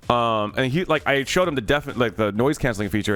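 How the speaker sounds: ordinary delivery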